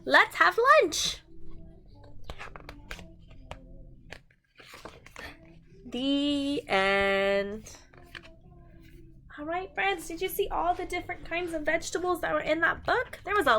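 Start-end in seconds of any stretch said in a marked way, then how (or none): 0.0-7.7 slow, emphatic read-aloud with big sing-song swings; animated storytime voice; reading to children. A narrator's reading. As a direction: read it out like a picture book for little ones. Reading picture book to children.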